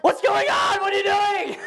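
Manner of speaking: high, loud laughing yell; alarmed shout. Retold or imitated. Husband shouting at wife.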